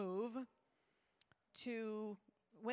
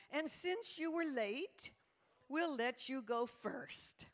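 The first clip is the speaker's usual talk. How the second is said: high, slow, sing-song imitation of a leader; enthusiastic youth leader; 5 semitones higher, 4 dB louder, slower than usual. Youth leader makes the latecomer go first, mock-enthusiastic. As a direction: draw it out high and over-sweet. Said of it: "enthusiastic, but very unenlightened youth leader".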